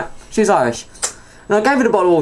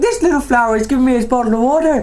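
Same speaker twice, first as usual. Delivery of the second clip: put-on drunk Irish lady; 6 semitones higher than usual. Voicing the drunk lady again.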